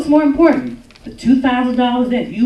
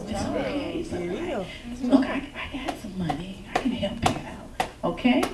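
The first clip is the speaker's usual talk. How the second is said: soft coaxing whisper; 10 dB quieter than usual. Retold or imitated. Other woman soothing husband.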